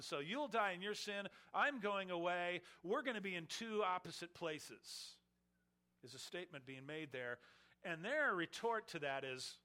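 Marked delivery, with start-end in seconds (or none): none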